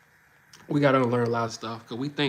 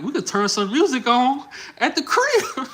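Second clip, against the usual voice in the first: pitched way up and louder, laughing through the words; laughing counteroffer; 10 semitones higher, 6 dB louder than usual. Retold or imitated. Telling a woman he met about staying in, laughing high. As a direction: laugh it off and offer to stay home instead. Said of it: "I'm like".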